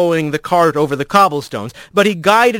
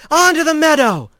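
shouted; 11 semitones higher than usual. Acted. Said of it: "she shouted".

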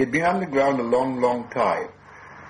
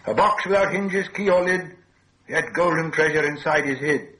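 high, louder, lilting riddle; riddle recital; 7 semitones higher, 4 dB louder than usual. A narrator's reading. Bilbo asking to gain time, high recital. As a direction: pitch it up and recite brightly.

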